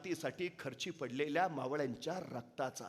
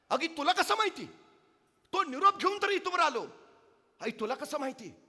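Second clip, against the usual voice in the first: high, loud stunned question; can't believe she already knows; king startled by the mother's calm; astonished king; 11 semitones higher, 9 dB louder than usual. Acted. Raje amazed the mother knows.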